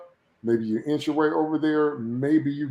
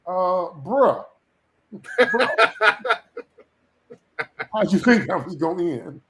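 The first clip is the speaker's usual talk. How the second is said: incredulous; 4 semitones higher, 4 dB louder than usual. Retold or imitated. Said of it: "And I'm like".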